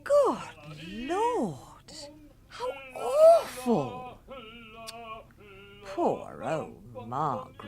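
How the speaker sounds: slow, sinking pity; dismayed. Acted. Wife pities Margaret, slow and dismayed. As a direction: let the sympathy drag out.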